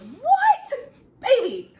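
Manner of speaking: high, loud shocked shriek; retelling her shriek at finding the ring; shocked cry. Retold or imitated. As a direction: squeal in disbelief at the ring. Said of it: "the first thing I said, I believe, was".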